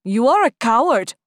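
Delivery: accusing. Acted. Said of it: "another voice interrupted".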